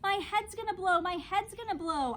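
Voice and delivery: high, loud panicky wail; frantic child shouting; panicked yell. A narrator's reading. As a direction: yell it running into the nurse's office. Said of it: "I yelled at the nurse".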